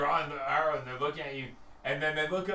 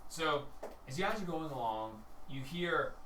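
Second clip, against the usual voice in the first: usual speaking voice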